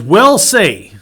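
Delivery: high, loud final "we'll see"; emphatic closing. Real, not acted. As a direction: ring out the refrain to close. Sermon's closing refrain.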